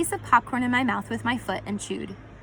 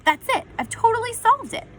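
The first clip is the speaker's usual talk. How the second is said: proud bark; 10 semitones higher than usual. A narrator's reading. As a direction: shout it like you've cracked the case. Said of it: "as he barked and said".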